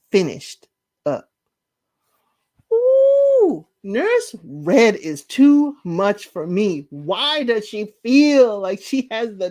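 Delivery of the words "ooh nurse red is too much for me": squealed shocked ooh; a high, loud gasp of scandal; real shock at the nurse; high exclaim. Real, not acted. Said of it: "I was getting heated".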